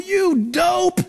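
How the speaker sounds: congregant's exasperated shout; exasperated outburst. Retold or imitated. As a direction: blurt it out at the pastor. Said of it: "I wanted to say to you".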